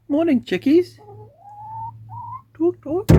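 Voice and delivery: high sing-song call to the hens; high voice to chickens. Real, not acted. Greeting the chickens.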